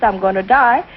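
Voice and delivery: usual speaking voice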